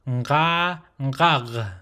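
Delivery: "nga" held open, "ngag" closed at the throat; nasal onset on "nga" and "ngag"; guttural nasal demonstration. Shown as a demonstration. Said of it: "that's how you pronounce nga".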